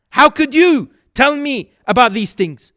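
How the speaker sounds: the teacher snaps back, stung and offended; indignant outburst. Retold or imitated.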